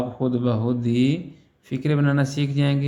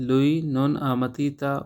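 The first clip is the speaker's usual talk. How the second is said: slow model sentence; much slower than usual. Shown as a demonstration. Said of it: "teacher repeats Italian slowly".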